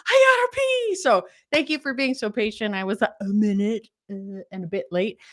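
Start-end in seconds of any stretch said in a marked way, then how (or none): 0.1-1.0 high, tight squeak of sudden need; urgent squeak. Retold or imitated. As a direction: squeak it like it's an emergency. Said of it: "And then I was like".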